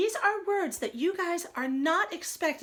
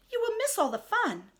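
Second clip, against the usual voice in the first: pleading Frog; 10 semitones higher than usual. Acted.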